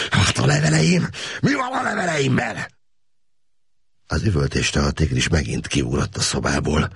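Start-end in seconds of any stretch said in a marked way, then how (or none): 0.1-2.7 hateful shout. A narrator's reading. Prisoner screaming at officers about his letters, hateful shout. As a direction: hurl the question at them. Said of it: "sziszegő gyűlölettel kiáltotta".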